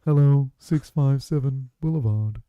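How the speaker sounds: slow, deliberate stalker-style announcement; put-on Watcher voice, weighty and slow. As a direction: draw it out slowly and ominously. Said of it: "The way he does it, he's like".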